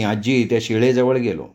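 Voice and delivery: ordinary delivery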